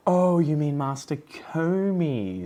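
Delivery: high, drawn-out mock realisation; exaggerated slow "oh, you mean" correction; mock crusty old scientist. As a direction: stretch it out like a pedantic old expert. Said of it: "crusty old, um, you know, scientists".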